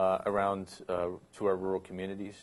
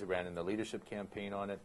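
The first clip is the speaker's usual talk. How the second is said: normal talking voice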